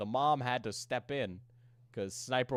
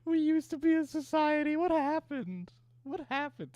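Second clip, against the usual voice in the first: much higher, hammed-up despair; mock lament. Streamer mocking her community, hammed-up high lament. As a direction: wail it like a dramatic meme.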